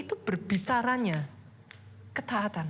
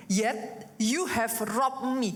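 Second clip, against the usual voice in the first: slow reading; 3 semitones lower, much slower than usual. Shown as a demonstration. Reading the verse in English.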